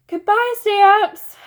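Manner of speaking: very high, slow, loud call. A narrator's reading. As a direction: call out from the doorway. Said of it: "Fudge called from the doorway".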